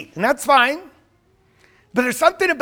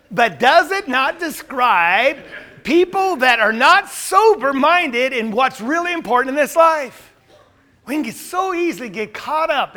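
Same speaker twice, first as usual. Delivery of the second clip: loud, raised pulpit voice; forceful preaching; 5 semitones higher, 7 dB louder than usual. Real, not acted. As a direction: drive the point home to the congregation. Preaches the point at full force.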